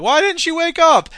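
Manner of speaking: high, loud mock panic; freaked-out students. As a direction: squeal it like a startled student. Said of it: "they're like".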